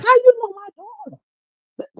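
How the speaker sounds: high, slow, startled "how you know my daughter"; startled question. Retold or imitated. Mom in the food line asking a stranger, slow and high in disbelief. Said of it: "I'm like".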